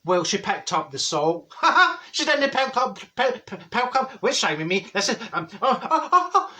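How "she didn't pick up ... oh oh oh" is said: higher, laughing through fumbled words; corpsing. Real, not acted. Cracking up at own apple pie story, corpsing. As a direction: lose it laughing and stumble on.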